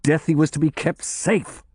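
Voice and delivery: exasperated oath, pitched high. A narrator's reading. Investigator fuming that a prisoner was not kept safe, exasperated. Angry oath at bad news.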